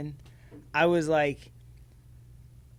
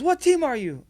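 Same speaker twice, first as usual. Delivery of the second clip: high, louder demanding question; the ref challenging him, annoyed; irritated ref; 10 semitones higher, 8 dB louder than usual. Retold or imitated. Ref demanding which team the shouting dad is on, irritated. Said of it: "he goes".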